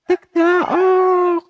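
very high, loud rooster crow "Tik, taok"; Tagalog rooster crow. Shown as a demonstration. Vlogger imitating the Tagalog rooster's crow. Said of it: "yung sound niya".

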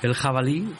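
ordinary delivery, nothing marked

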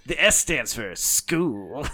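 slow, drawn-out mock announcer; hammed-up DJ punchline; radio DJ voice. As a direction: stretch it out like an FM jingle. Joking on in DJ voice.

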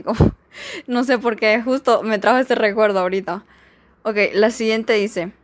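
ordinary delivery

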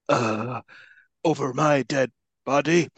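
slow, halting mock bad acting; struggling to remember lines. Mocking an actor who forgets his lines, slow and halting. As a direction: labour over each word like a lost actor. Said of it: "struggling to remember every individual word".

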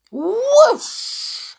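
high, drawn-out whoosh. A narrator's reading. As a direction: let the whoosh fly off like the tray. Tray flying down stairs.

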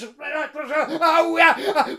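high, loud cries of pain; pained yelps. Acted. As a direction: yelp as the thorns cut. Hurt freeing her.

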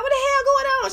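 high bewildered question; groggy confusion. Retold or imitated. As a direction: act startled awake. Waking up confused.

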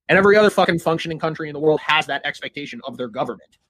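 ordinary talk

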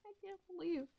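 breathy laugh through the words, quick; laughing disbelief. Real, not acted. Recalling the meme, she laughs the words out.

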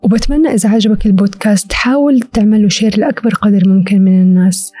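usual speaking voice

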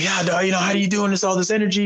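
mock-excited high voice; hyped-up buddy. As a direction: imitate a pumped-up friend raving. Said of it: "He's like".

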